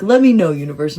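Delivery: usual speaking voice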